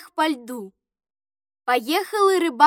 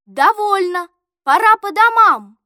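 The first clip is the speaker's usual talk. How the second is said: raised, firm call to the group; father speaking up; 3 semitones higher, 7 dB louder than usual. A narrator's reading. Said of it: "Володин папа сказал".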